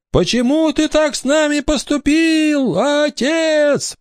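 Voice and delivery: very high, long ghostly wail; crying out reproachfully. A narrator's reading. Ghost girl confronts pirate as father, high wailing cry. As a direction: wail the accusation, stretching "father". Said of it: "прокричала Элеонора".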